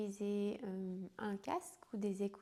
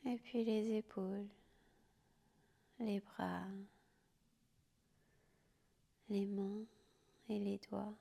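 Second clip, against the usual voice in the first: guided sleep meditation. Acted. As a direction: whisper them gently down into sleep. Yoga nidra guided to sleep.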